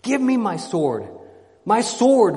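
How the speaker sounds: loud, high cry for a weapon; shrieking demand. A narrator's reading. Frenzied knight demanding his sword, loud shriek. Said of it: "rising into a shriek".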